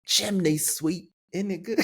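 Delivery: high, playful put-on British accent. Shown as a demonstration. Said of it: "doing a good British accent".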